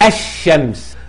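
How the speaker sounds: الشمس with no l, "ash-shams"; silent sun lam. Shown as a demonstration. Teacher showing the sun lam, l vanishing in الشمس. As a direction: drop the l and double the sh in الشمس. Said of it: "تختفي من النطق".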